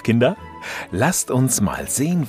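normal talking voice

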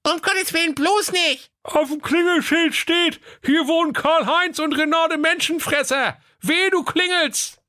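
put-on high squeaky kids' voices, urgent; frightened children. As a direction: panicky kids warning their brother.

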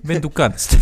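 put-on laughing through a menacing Russian accent; creepy Russian-accented threat, half laughing; russian accent in german. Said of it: "wenn jemand was mit einem russischen Akzent sagt".

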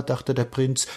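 ordinary delivery, nothing marked

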